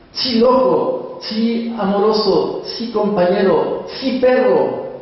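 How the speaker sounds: high, slow, coaxing calls to a beloved dog; tender talk to the dog. Acted. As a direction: coo each name up high and let it fall. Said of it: "Yo lo llamo entre sotil y castellano".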